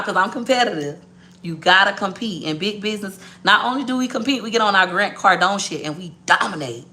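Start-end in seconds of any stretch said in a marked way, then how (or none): none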